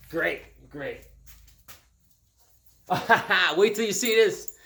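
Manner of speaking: ordinary talk, nothing marked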